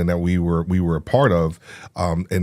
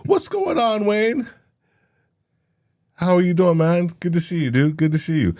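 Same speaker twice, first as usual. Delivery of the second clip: christmas elf; 9 semitones higher than usual. Retold or imitated. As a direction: greet them like a chirpy elf. Greets viewers in elf voice.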